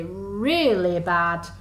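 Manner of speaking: ordinary delivery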